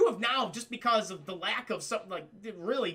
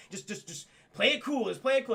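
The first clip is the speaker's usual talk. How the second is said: urgent instruction, close to the usual voice; urgent calming; 4 semitones higher, 3 dB louder than usual. Retold or imitated. Cat friend steadying panicking Antoine, urgent instruction. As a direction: coach a panicker through it insistently. Kat telling Antoine to calm down.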